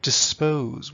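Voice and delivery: dis-POSE, loud on "pose"; "dispose" as a weak-strong iamb; stress on second syllable. Shown as a demonstration. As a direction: hit the end of "dispose". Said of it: "That's the heavy beat".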